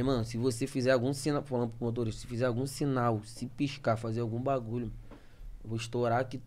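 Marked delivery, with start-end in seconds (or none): 0.0-1.4 quiet, lower-pitched robber's warning; low retold threat. Retelling the robber warning the driver, low quiet threat. 2.2-6.4 quiet, lower-pitched robber's threat; low retold threat. Retelling the robber threatening the driver, low quiet menace.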